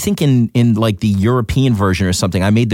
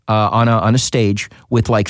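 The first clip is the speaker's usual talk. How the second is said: ordinary talk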